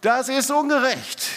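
put-on indignant child. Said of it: "du kannst dir vorstellen, was dann kam".

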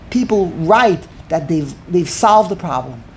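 ordinary talk, nothing marked

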